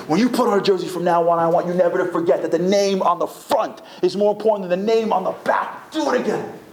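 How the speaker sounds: insistent retold coach's order; demanding coach. Coach berating his exhausted players.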